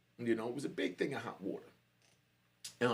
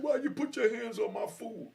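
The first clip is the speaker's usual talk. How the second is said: high-pitched imitation of an angry demand; retold angry challenge; 10 semitones higher, 4 dB louder than usual. Angry dude confronting the food taker, retold challenge. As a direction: act out his confrontation, pitched well up.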